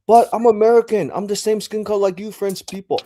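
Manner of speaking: high, loud indignant Karen; mock Karen protesting. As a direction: protest like an outraged entitled tourist. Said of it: "And Karen's like".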